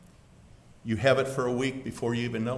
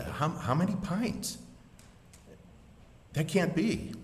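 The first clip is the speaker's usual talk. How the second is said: stunned pleading father; 6 semitones higher, 5 dB quieter than usual. Acted.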